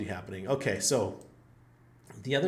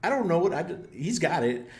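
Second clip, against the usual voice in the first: exasperated reply; 8 semitones higher, 5 dB louder than usual. Retold or imitated. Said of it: "I said".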